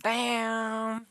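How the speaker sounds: high, drawn-out exclamation; exclaimed damn. Retold or imitated. Player reacting to the night's outcome, exclaimed. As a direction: let it ring out high and long. Acting out own reaction to story.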